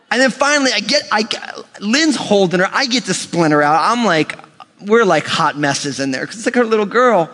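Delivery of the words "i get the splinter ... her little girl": ordinary talk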